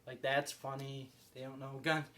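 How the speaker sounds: usual speaking voice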